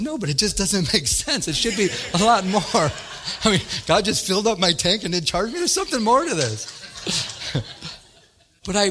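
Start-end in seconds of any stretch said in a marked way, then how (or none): 0.0-7.6 chuckling, louder, protesting it makes no sense; laughing disbelief. Real, not acted. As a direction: laugh through the objection. Amused disbelief at gas price.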